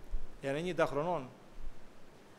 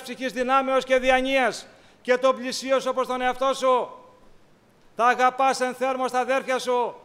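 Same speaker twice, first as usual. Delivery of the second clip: ringing sermon exhortation; preaching; 6 semitones higher, 8 dB louder than usual. Acted. Preacher urging brothers to love and forgive, in a full sermon voice. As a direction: urge the flock, full voice.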